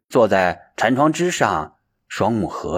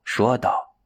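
ordinary delivery

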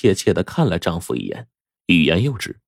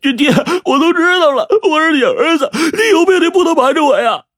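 high, wailing reproach; crying; 4 dB louder than usual. A narrator's reading. Son who has learned of father's illness, crying. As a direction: sob the words out at a high pitch. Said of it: "大声哭道".

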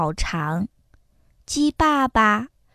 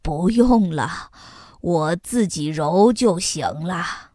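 low, tired dad voice; weary father; 7 semitones lower than usual. A narrator's reading. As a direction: gently wave off the help. (疲惫低沉的爸爸)

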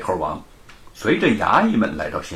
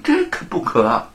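higher, flustered no no; startled refusal; 9 semitones higher than usual. A narrator's reading. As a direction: shrink back shaking head. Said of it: "身子那么一激灵，摇摇头说". (吓了一跳连连摆手)

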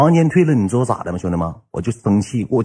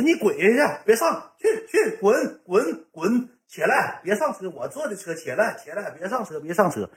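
mock angry protest; 8 semitones higher, 7 dB quieter than usual. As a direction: imitate shooing someone away, high-pitched and soft. Acts out shoving someone from the car. (压着嗓子尖声赶人)

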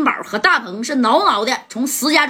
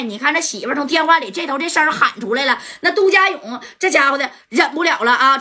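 plain reading voice

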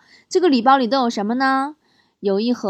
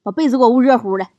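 loud, brusque mock order; impatient wife; 5 dB louder, faster than usual. Wife ordering feverish husband to warm the quilt, impatient and loud. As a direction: imitate an exasperated wife, louder. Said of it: "媳妇儿白了我一眼说".

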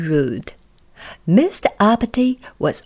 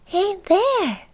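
very high little goblin; 12 semitones higher than usual. Acted. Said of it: "said the Goblin".

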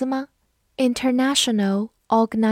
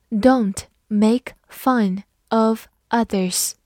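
each word slow and drawn out; careful slow reading; much slower than usual. Shown as a demonstration. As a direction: read it slowly for learners. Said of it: "好，慢读一遍。".

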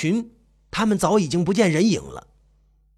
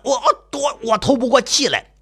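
choked, straining, halting words; being strangled; 6 semitones higher than usual. Acted. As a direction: force words out through a squeezed throat. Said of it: "我的喉管掐死了".

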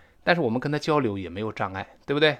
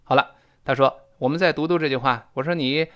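usual speaking voice

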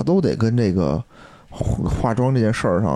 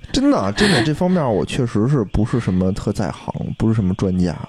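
normal talking voice

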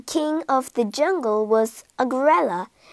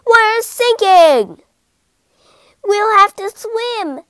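higher, louder, slow panic; panicked shout; 9 semitones higher, 8 dB louder, slower than usual. A narrator's reading. Cat as the boat sinks, panicked shout. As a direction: cry out in alarm.

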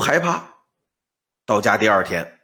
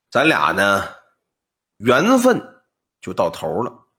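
put-on old man voice; 9 semitones lower than usual. Old man speaks to scholar.

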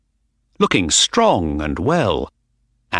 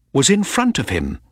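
ordinary delivery, nothing marked

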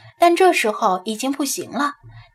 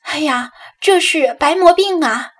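higher, louder cry of surprise; startled exclamation; 5 semitones higher, 5 dB louder than usual. A narrator's reading. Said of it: "柳莹惊讶地小声惊呼".

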